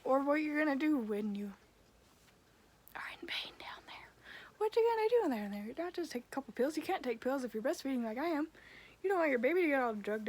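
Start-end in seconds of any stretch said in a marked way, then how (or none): 2.9-4.1 hushed aside about a private pain; whisper. Real, not acted. To her viewers about pain down there, whispered. Vlog, lowering voice on private topic. 4.6-5.8 exasperated high question. Real, not acted. Exasperated rhetorical question to viewers.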